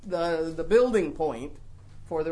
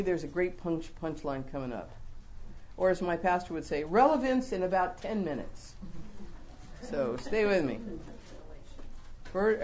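ordinary delivery

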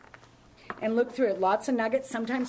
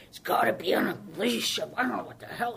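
retold angry man; 5 dB louder than usual. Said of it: "he started yelling at me".

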